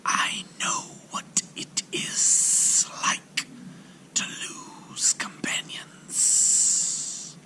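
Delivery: slow, grieving whispered serpent; a lonely old snake breathing out its loss; sorrowful serpent whisper. A narrator's reading. Serpent tells the sailor of loss, whispering sadly. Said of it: "answered the serpent".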